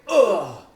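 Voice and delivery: loud pushing groan; straining groan. Retold or imitated. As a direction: groan as the push gives. Acting out straining on the toilet.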